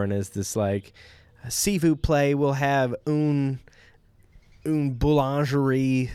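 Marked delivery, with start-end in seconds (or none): 1.5-6.1 put-on French in a thick American Southern accent; higher, slow, drawn-out Southern US accent on French words; Betty's Southern-accented French. Missionary's wife ordering from waiter, thick Southern-accented French. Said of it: "with the thickest American Southern accent".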